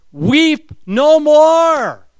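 booming elder proclaiming the Lion. Acted. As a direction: announce it like a herald in glory. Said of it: "an elder says".

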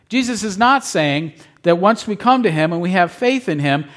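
normal talking voice

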